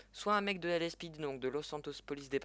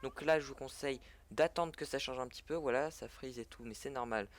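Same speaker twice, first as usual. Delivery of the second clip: normal talking voice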